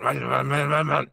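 grumbled nonsense syllables in quick repetition; mock grumbling. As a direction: imitate a muffled grumble under a helmet. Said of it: "hear him kind of grumbling".

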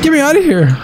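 high desperate cry; desperate plea. Real, not acted. Streamer trapped in the sewer, high desperate cry. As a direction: beg to escape. Panic in horror game.

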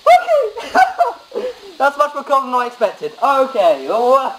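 high, laughing through the cold; laughing from cold shock. Real, not acted. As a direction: gasp and laugh, drenched. Just soaked in ice water.

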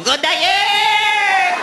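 imitating a fan yelling at the stadium; party shout. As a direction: holler it slowly across the parking lot. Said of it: "Mexican dude going".

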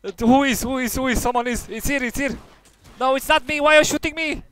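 high, loud panicked shouting; panicked yelling. Real, not acted. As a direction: yell in confused panic. Being shot, panicking.

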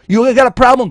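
loud, high confrontational shout; angry challenge. Real, not acted. Streamer yelling a challenge at British viewers, angry. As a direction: dare them, loudly.

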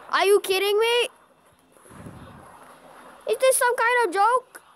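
high, indignant outburst; outraged disbelief. Real, not acted. Player protesting what the game just did, outraged disbelief.

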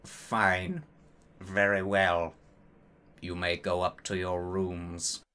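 low, quiet, deliberate voice with pauses; Professor Snape. A narrator's reading. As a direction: speak low and soft, pausing between phrases. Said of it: "but Professor Snape said".

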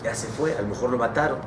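low, subdued resignation; acted quiet despair; despairing father. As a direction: give up hope, softly and low. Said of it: "ya estaba desesperado".

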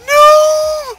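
high panicked scream of no; terrified scream. Real, not acted. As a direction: scream it in sheer terror. Monster appears, being chased.

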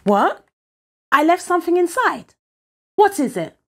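high, urgent questioning; startled child's demand; alarmed surprise. Acted. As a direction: blurt it out in rising panic. Boy alarmed at losing hidden thing.

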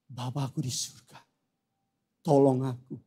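very soft, low prayer; hushed plea. Acted. Wounded believer calling on the Father, hushed plea. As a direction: plead quietly, leaving space between phrases. Hurting believer pleading with God.

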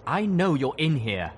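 gruff colonel, loud and high. A narrator's reading. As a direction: call out gruffly to someone hiding. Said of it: "he said gruffly".

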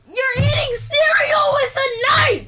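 high, shrieking yell; banshee shriek. Acted. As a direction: screech it out like a banshee. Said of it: "I sound like a banshee".

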